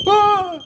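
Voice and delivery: sudden high, loud yelp; startled yelp. Real, not acted. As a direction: cry out in surprise. Startled again.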